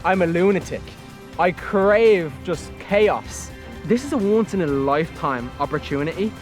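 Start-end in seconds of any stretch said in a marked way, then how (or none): none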